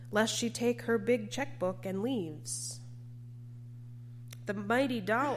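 normal talking voice

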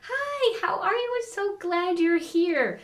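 chorus of suddenly delighted hosts; gushing family welcome. Retold or imitated. Whole family greets sweetly.

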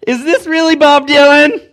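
very loud, high-pitched shout of disbelief; hammed-up shriek into the phone; mock freak-out. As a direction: act out a comic, overwhelmed yell. Acts overwhelmed reaction on the phone.

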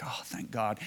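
low, very soft sigh of thanks; quiet relief. Retold or imitated. Man finished forgiving at the back of a service, quietly relieved. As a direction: let it out softly, relieved.

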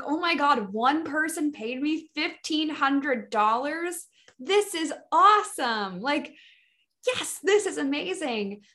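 high, bright thrilled exclamation; squealing over a big sale; excited gush. Retold or imitated. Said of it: "I was like".

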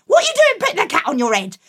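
high, loud exasperated scolding; despairing, fussing mum. Acted. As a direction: mum scolding her silly son.

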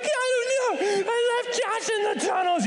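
high tearful child's wail, hammed-up; mock panicked wail. Kid confessing to dad about abandoned brother, mock hysterical wail.

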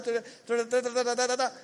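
mock chatter. Mimics endless chatter.

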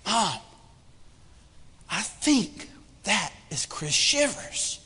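softly, with halting pauses; hushed confiding. Retold or imitated. Boy leaning over to his mom in an airport, hushed.

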